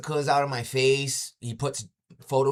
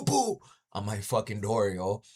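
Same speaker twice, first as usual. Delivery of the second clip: usual speaking voice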